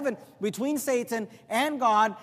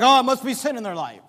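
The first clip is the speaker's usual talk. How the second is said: louder mock verdict on someone else; mock judgmental; 3 semitones higher, 7 dB louder, faster than usual. Churchgoers judging another's hardship, mock judgmental. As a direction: exclaim it as a jumped-to conclusion. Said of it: "And we're like".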